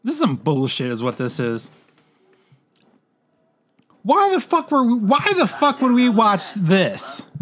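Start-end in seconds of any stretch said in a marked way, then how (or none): 4.0-7.3 high, drawn-out disbelieving complaint; mock outrage at yourself; exasperated. Real, not acted. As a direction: throw up your hands in disbelief. Friend annoyed at wrong movie.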